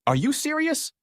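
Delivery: high-pitched, incredulous snap; snapped. A narrator's reading. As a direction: snap back in disbelief. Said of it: "Howard snapped".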